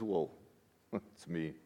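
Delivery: low, very soft, amused reply; understated, hushed answer; soft reassurance. Acted. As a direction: say it sheepishly small with a little laugh. Acting Jesus answering the frightened disciples.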